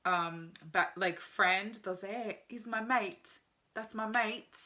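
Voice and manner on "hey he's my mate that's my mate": slow, lightly British "my mate"; a hint of a UK accent on "mate"; mild mock British "he's my mate". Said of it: "Instead of saying, um, like, friend".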